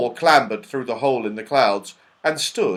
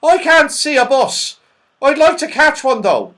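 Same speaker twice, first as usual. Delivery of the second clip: high, loud comic voice; Saucepan Man shouting back; 14 semitones higher, 10 dB louder than usual. A narrator's reading. Saucepan Man looks round after mishearing, shouting high. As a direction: call back cheerfully muddled, high-pitched. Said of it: "shouted back the saucepan man".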